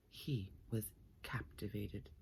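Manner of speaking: breathy whisper, hushed; hushed storybook whisper. A narrator's reading. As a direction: whisper it like a secret. Narration of enchantment.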